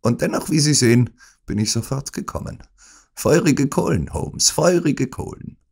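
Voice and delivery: high, brisk mocking visitor; gloating villain. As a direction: taunt the helpless patient. Smith gloats at sick Holmes. (höhnischer Besucher)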